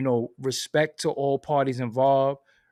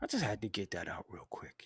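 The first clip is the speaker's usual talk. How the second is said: much softer, whispered aside; 6 semitones lower, 14 dB quieter than usual. Real, not acted. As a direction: hush it after the rant. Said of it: "You can see I'm calm".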